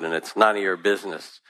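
usual speaking voice

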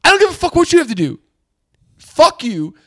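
high, loud outraged telling-off; furious imagined rant. Retold or imitated. To the guy who shoved past, an imagined high, loud rant. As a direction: let him have it, way up high. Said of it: "I wanna be like".

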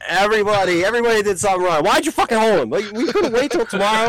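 high-pitched, half-amused griping; retold exasperated rant. Fan ranting at the lineman who held, exasperated. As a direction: scold the players in mock outrage. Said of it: "I'm, like, yelling at".

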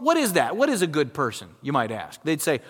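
ordinary delivery

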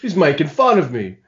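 playful imitation of an offended friend; mock complaint. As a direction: imitate someone feeling teased. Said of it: "you were just like".

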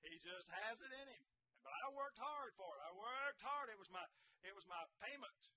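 loud, high pulpit testimony; fervent preaching. Acted. Preaching at full force.

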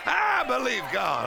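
high-pitched revival fervour; full-force preaching. Real, not acted. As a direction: build it fervently for the congregation.

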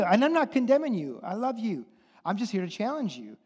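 normal talking voice

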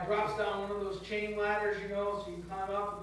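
ordinary talk

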